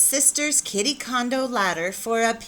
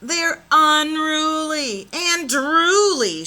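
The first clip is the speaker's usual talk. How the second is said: higher, louder and slow, hammed up; dramatic; 7 semitones higher, 6 dB louder, slower than usual. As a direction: play it like a stage diva. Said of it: "she added dramatically".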